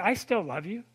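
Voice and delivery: soft, quiet voice of God replying; God answering gently. Pretend. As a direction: answer softly as a loving God.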